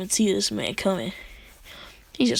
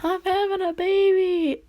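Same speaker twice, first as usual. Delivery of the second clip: much higher excited announcement; excited yell; 10 semitones higher than usual. Retold or imitated. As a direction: blurt out the big news. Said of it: "And then she yells".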